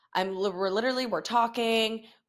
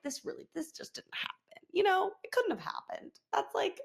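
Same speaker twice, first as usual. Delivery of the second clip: high, hushed denial; retold stunned disbelief, small and soft; in shock; 10 semitones higher, 7 dB quieter than usual. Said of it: "I was, like, in shock".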